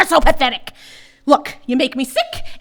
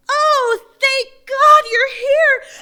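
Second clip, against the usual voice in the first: fake tearful relief; 11 semitones higher than usual. Daughter caught, feigning tears to her father. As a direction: play the innocent victim in tears.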